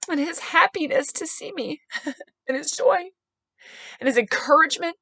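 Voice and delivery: real high, choked-up gratitude; tearfully moved. Real, not acted. Woman recalling a stranger's kindness, tearfully moved.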